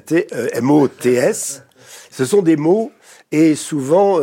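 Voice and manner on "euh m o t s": M O T S spelled slowly, letter by letter; spelling out letters. Shown as a demonstration. Explaining that mots is spelled with a T, slowly spelling M O T S. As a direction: spell the word mots aloud, one letter at a time. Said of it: "avec un T".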